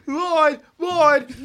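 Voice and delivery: loud high quote. Retold or imitated. Repeated movie quote.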